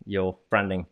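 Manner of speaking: ordinary delivery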